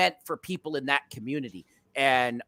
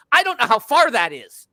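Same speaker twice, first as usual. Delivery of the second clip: raised, climbing comic exasperation; higher-pitched, worked-up protest; exasperated rant; 6 semitones higher, 5 dB louder than usual. Retold or imitated. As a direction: rant in mock bewilderment at a useless measurement. Acted rant at phone's kilometre reading.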